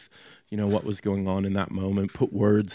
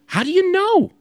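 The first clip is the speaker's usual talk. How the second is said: dramatic high question; 6 dB louder than usual. Acted. Repeated question, first take heightened.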